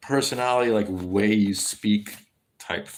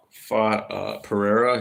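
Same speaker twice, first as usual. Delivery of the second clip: ordinary talk, nothing marked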